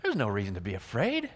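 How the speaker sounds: mild relieved reassurance to yourself. Retold or imitated. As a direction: breathe out, the worry is gone. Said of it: "And you're like".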